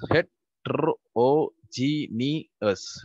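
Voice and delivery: drawn out syllable by syllable, very slowly; teacher spelling out Heterogeneous slowly for the class; slow syllabified. Shown as a demonstration. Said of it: "we are going to syllabify the following words".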